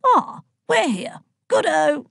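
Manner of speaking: high, slow, hearty arrival call; jovial Zeus. A narrator's reading. Zeus arriving by flying horse at Brighton, jovial. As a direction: announce it with drawn-out relish.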